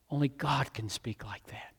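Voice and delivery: sudden hushed whisper. Acted. Pastor confiding to the congregation in an awed whisper. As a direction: drop to a breath for awe. Hushed aside after shouting.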